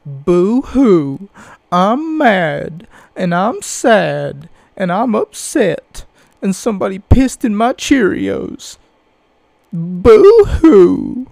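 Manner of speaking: fake crying. Mocking complaining fans.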